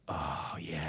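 very low, soft, lingering reaction; hushed approval. Retold or imitated. Recalling finding out about the Playboy model, hushed low approval. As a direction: sink into a low, knowing near-murmur.

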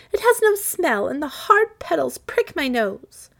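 put-on high, loud childish protest; complaining little girl. A narrator's reading. Daughter complains about the hard golden rose, petulant and high-pitched. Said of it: "cried Marigold".

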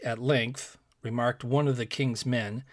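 ordinary delivery, nothing marked

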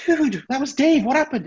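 high, loud urgent questioning; acted alarm. Colleague confronting the man behind the desk, acted alarm. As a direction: fire it out in mock disbelief.

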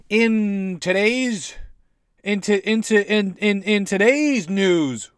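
high, loud, slow stretched news opener; newsreader intro. Pretend. Comedian opening his news bit, hammed-up anchor voice.

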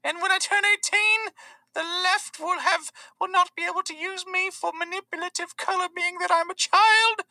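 put-on very high, slow child parody; Greta impression. Activist lecturing about being used, high slow parody. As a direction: lecture in a squeaky mock-aggrieved voice.

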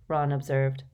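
ordinary delivery

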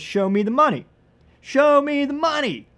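high, loud, rising into a yell; shouted movie quote. Retold or imitated. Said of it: "Cuba Gooding Junior says".

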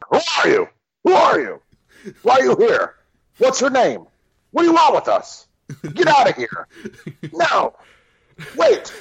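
high, mock-urgent stock lines; stock dub voice. Parodying the movie's repeated dub lines, high urgent voice. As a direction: rattle off hammed-up canned exclamations.

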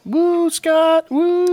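high excited cheer. Real, not acted. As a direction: whoop it up for Scott. Cheering the guest speaker.

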